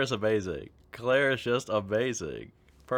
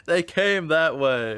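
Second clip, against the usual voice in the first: laughing; 5 semitones higher, 7 dB louder, slower than usual. Real, not acted. Laughing at the flowers.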